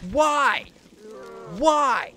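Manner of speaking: high, loud wail of frustration; anguished yell. Real, not acted. Player after dying, anguished high yell. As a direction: cry out at the unfairness. Wailing at repeated game death.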